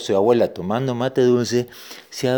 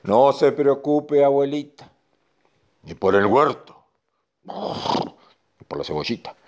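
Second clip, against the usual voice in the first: reassuring bull; 4 semitones higher than usual. Acted. Bull telling grandma not to worry, measured reassurance.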